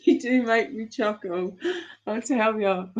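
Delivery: chuckling. Real, not acted. Still chuckling at the joke.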